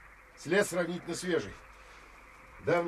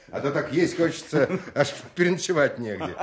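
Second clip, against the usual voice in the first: laughing joke; 7 dB louder than usual. Acted. Joking folk saying with laughter.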